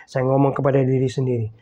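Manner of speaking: ordinary talk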